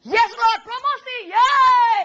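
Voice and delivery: high, loud mock cheering; mock excited cheer. Mocking a sinner called to church, cheering. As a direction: squeal it like a thrilled fan. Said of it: "saya nggak percaya Tuhan datang gini".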